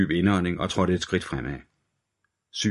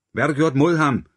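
high, loud shout with "mod" hit hard; furious shout down the phone, "mod" stressed and falling; 8 semitones higher, 5 dB louder than usual. A narrator's reading. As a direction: yell it at the kidnapper, louder this time. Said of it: "råbte Hunter igen".

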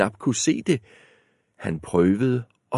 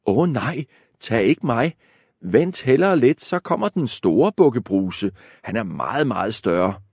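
middle goat pleading; 3 semitones higher than usual. Acted. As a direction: beg the troll to wait.

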